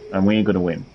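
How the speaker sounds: ordinary talk, nothing marked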